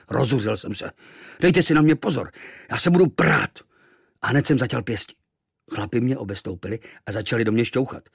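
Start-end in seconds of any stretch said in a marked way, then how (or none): 1.4-3.6 put-on higher, louder angry boy; defiant threat. Boy threatening the sailors, loud and defiant. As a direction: puff up and threaten them.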